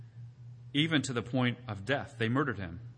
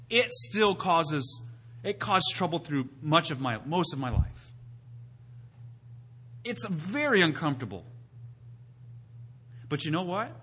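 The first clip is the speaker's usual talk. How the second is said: ordinary delivery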